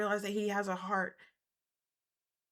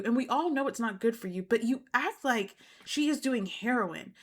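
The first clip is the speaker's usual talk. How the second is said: ordinary talk, nothing marked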